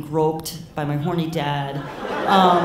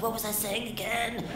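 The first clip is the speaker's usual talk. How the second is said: higher, soft, lost-her-thread voice; mock stoned mom; 4 semitones higher, 7 dB quieter than usual. Mom losing track mid-talk, mock stoned voice. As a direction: lightly imitate a mother who is high. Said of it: "like, really nonlinear".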